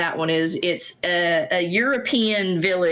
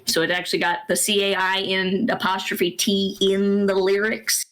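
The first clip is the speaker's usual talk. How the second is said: ordinary talk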